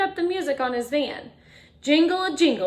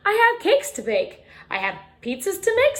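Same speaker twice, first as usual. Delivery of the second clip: higher and louder; cheerful baker; 9 semitones higher, 4 dB louder than usual. A narrator's reading. Baker happily getting back to work after the rain. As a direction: announce it brightly like a busy baker. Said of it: "said the".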